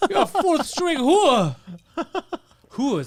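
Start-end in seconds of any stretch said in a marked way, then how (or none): 0.1-1.5 high, loud, drawn-out mock insult; mock shouted putdown. Riffing on a bragging groupie, mock shouted putdown. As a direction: holler it slowly for comic effect.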